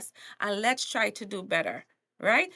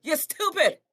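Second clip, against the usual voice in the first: louder, higher preacher scolding; T D Jakes scolding; 5 semitones higher, 6 dB louder than usual. Retold or imitated. Preacher shaking up a sinner, loud rebuke. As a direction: shake the listener with a preacher's rebuke. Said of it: "a T D Jakes to shake you and be like".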